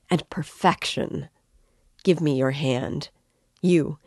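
plain reading voice

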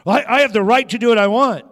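loud, high mock defiance; defiant child. Preacher mocks a child defying parents, loud and high. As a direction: play a bratty kid insisting on rights.

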